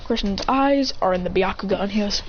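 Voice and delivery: plain reading voice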